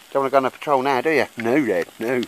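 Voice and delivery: put-on singsong baby talk to a dog; playful voice to the dog. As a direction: coax the dog, then answer for it. Teasing the dog, answering for it.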